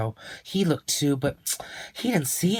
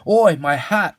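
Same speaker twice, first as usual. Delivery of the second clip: louder startled cry; cried out; 5 semitones higher, 8 dB louder than usual. Acted. Old man cries out when his hat flies off. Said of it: "cried Mister Zinger".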